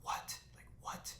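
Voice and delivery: whispered, stunned disbelief; retold hushed, crushed reaction; dread and disappointment. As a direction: mouth it on breath alone, imitating someone dismayed. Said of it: "disappointment of".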